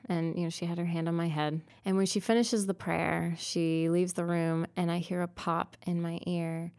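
usual speaking voice

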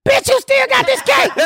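high, shouted disbelief; shocked disbelief. Retold or imitated. Seeing the cake come out again, high shocked shout. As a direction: yell it out in shock.

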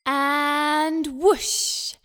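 loud excited whoosh. Acted. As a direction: swoop in with the landing. Plane landing.

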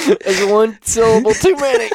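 high, wheezy laughing remark; real laughter through the words; helpless laughter. Real, not acted. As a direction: squeeze the words out while cracking up.

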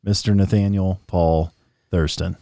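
big radio host intro; announcer voice. Acted. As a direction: hype up the show open like an announcer. Podcast host intro over music.

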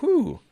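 a high, breathy sigh of relief; high relieved whew. Retold or imitated. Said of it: "I'm like".

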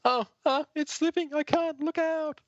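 high, straining panic; panicked girl voice. Acted. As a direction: squeal it like she's losing grip. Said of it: "And Sarah goes".

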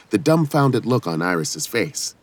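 plain reading voice

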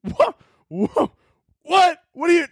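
high, sharp repeated disbelief; shocked high yelps; incredulous squeal. Real, not acted. Incredulous reaction to Twitter quote.

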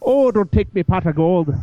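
high put-on Irish leprechaun voice. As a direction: play a cheeky leprechaun guarding his gold. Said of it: "you wanna try a Notre Dame Fighting Irish, uh, sound effect".

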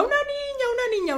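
very high, like a parrot; parrot-like repeating. Retold or imitated. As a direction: echo it mindlessly. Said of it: "un lorito". (repetido como un loro, muy agudo)

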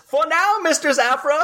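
high, prissy droid voice; Triple Zero droid voice. Retold or imitated. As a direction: purr it like a sinister butler droid. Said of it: "Triple Zero pops up and says".

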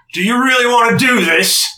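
loud, high-pushed monster challenge; big bull-man boss voice; booming Minotaur. Acted. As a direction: bellow a threat down at intruders.

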